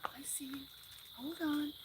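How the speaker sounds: high playful whisper. Real, not acted. Owner spots a hiding cat, high soft whisper. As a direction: whisper so you don't scare it.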